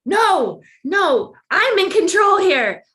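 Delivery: retold shout. Retells panicking at his EKG.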